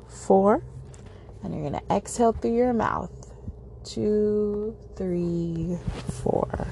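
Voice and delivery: slow, calm counting through each breath; guided breathing. Acted.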